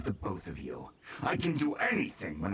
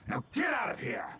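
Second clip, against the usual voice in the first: shouting; 11 semitones higher than usual. Acted. Villain dismisses the father.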